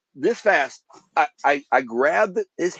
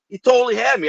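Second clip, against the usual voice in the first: higher, louder, shaken recounting; reliving the fear; 10 semitones higher, 5 dB louder than usual. Real, not acted. Telling hosts the shooter had him, high and charged. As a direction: let the near-death moment rise in your voice.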